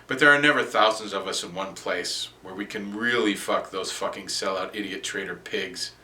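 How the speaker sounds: ordinary delivery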